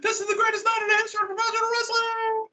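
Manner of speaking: high-pitched, shouted wrestling announcer hype; Tony Schiavone, over-the-top. Acted. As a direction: bellow it like a commentator turned up to eleven. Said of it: "had Schiavone just up to eleven".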